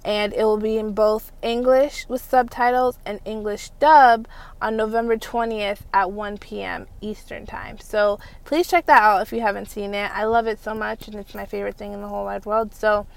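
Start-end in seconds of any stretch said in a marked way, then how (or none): none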